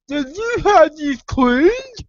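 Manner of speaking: high, slow, stretched question; put-on child role. Child with pliers in the mouth, high slow garbled question. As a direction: ask it high and muffled, drawing out each word. Child answering the mad father.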